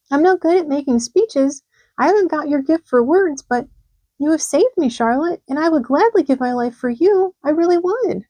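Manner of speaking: put-on high, eager young pig voice; high earnest piglet; Wilbur, earnest. A narrator's reading. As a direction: pipe up with sincere devotion.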